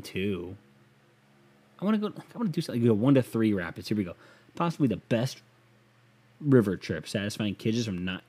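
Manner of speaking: usual speaking voice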